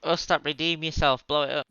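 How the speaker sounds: loud, eager call to a teammate; loud urging. Real, not acted. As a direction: cheer him on loudly.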